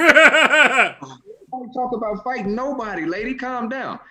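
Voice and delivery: high, loud and slow, through laughs; laughing calm down; laughing scold. Retold or imitated. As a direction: scold her while laughing. Comic telling heckler off.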